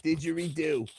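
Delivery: put-on high, soft old lady voice; a fussy little English granny. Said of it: "Why are you talking like an old English lady?".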